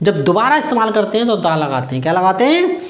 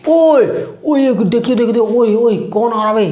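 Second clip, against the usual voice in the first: alarmed call; 3 semitones higher than usual. Pretend. Acting alarm at approaching robbers.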